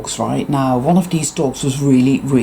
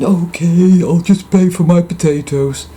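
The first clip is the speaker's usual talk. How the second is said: meek biker; 4 semitones higher, 4 dB louder than usual. Retold or imitated. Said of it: "he just meekly went".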